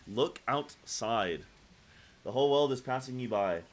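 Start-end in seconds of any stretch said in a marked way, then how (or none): 0.1-1.4 drawn out, slow repeat; slow echo. Real, not acted. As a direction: say it slowly, musing. Streamer echoes the callout slowly.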